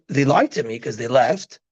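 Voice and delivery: usual speaking voice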